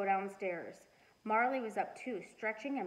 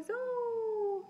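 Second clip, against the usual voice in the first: put-on very high baby call; high toddler squeal; toddler shout; 13 semitones higher, 3 dB louder than usual. A narrator's reading. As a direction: call out like a tiny kid. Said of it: "baby Louie shouted".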